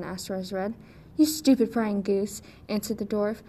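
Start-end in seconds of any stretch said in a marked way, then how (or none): 1.2-2.4 angry insult. Acted. Dwarf insults Rose Red.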